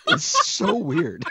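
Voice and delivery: drawn-out, high words through laughter; laughing. Real, not acted. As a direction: crack up while saying it, slow and high. Cracking up over the day.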